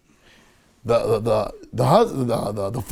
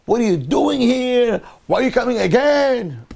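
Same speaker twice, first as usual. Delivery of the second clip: mock outraged yell in a raised pitch; retold yelling; 7 semitones higher, 3 dB louder than usual. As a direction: act out her yelling, pitched up and slowed. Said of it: "she starts to yell".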